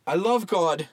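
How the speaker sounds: yelled. Acted. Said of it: "yelled Walton".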